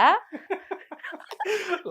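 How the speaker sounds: high, loud burst of laughter; real laugh. Real, not acted. Interviewer amused by guest's slang rant, laughing out loud. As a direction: crack up helplessly.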